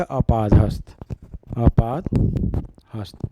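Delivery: अपादहस्त stretched out syllable by syllable; very slow dictation. Shown as a demonstration. Teacher dictating अपादहस्त to students, very slow. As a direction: say अपादहस्त slowly so students can copy it. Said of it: "teacher drills the tatsam word".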